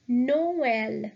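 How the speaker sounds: "Noël" as no-EL, both vowels sounded; separate vowels. Shown as a demonstration. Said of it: "pronounce both these vowels separately".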